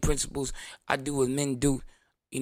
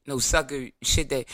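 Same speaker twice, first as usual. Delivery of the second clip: ordinary talk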